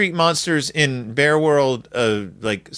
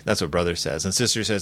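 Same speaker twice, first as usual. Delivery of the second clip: ordinary talk, nothing marked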